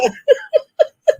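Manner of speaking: 1 high wheezing laugh. Real, not acted. Teller laughs at own story.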